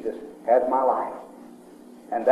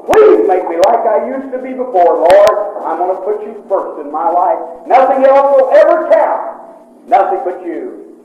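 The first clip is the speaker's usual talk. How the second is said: raised, pleading cry to God, much higher and louder; desperate prayer; 8 semitones higher, 9 dB louder, slower than usual. Retold or imitated. Man retelling his prayer of repentance, impassioned loud plea. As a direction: beg God for forgiveness at full voice.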